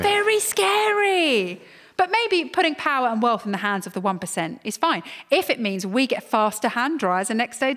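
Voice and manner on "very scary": slow, drawn out mock dread; mock spooky. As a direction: ham up the spookiness. Mock fear at billionaires.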